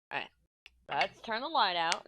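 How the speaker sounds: usual speaking voice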